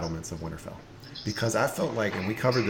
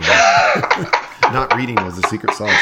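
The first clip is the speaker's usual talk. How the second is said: louder, words breaking into laughter; laughing; 3 semitones higher, 9 dB louder than usual. Real, not acted. Host cracking up at a joke about not reading the book, laughing. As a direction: laugh through the self-mocking line.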